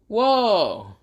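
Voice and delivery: high delighted exclaim; excited whoa. Acted. Boruto thrilled after eating the villain, excited. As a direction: burst out thrilled.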